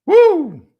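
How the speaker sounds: sudden high, loud "woo". Real, not acted. Host reacts to scandal rumours with a high whoop. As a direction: let out a shocked whoop.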